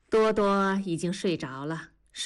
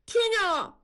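put-on much higher, louder boy's answer; boy made to answer louder, high and loud; 14 semitones higher than usual. A narrator's reading. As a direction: blurt it out loud and high like a scolded kid. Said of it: "大宝放大了声音说".

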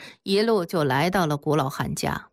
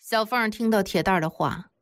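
plain reading voice

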